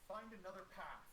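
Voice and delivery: middle-aged female elf voice. Acted. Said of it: "middle-aged female elf voice".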